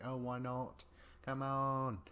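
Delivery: put-on a half-hearted girlish plea, barely changed from his own voice; terrible Dee impression. A castmate lightly imitates Dee coaxing Jay, in a mock pleading tone. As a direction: lightly coax him along in a weak imitation of her. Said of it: "It's a terrible Dee impression".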